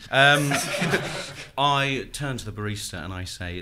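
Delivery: ordinary talk, nothing marked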